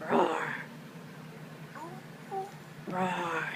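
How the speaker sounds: low, breathy growled roar; bear roar. Pretend. Parent showing Abby the brown bear, low growled roar. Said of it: "Abby, brown bear".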